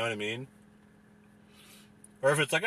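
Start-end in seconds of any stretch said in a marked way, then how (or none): none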